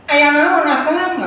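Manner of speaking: very high mock-feminine voice; put-on female voice. Shown as a demonstration. Iteung asking her husband what's wrong, high put-on female voice. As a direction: pitch it way up like a caricatured woman. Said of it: "tong jadi sora awewe".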